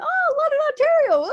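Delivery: very high, loud mock squeal; retold excitement. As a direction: imitate thrilled recognition, loud and high. Retold excited meeting of fellow Canadians.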